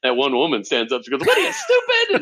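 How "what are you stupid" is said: very high, loud mock-outraged jab; indignant outburst. Employee standing up to challenge the shaken manager, indignant mock outburst. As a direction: imitate a scandalized heckler, pitch leaping way up.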